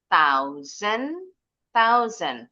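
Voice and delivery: THOUSAND with a z sound for the s; s seperti z. Shown as a demonstration. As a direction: say THOUSAND as "thau-zen". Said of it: "S-nya bunyinya seperti Z". (THOUSAND, huruf s berbunyi z)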